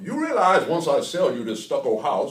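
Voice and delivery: high, loud comic character voice; old-time comedy character. Acted. Selling a stucco house in an old comedy routine, hammed-up. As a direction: push it high and loud like a corny old radio act. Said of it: "I loved Amos and Andy".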